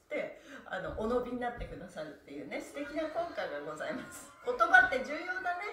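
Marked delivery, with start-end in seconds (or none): 4.4-5.7 says it through a loud burst of laughter; amused, laughing exclamation. Real, not acted. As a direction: laugh it out, louder and higher. Laughing remark to viewers.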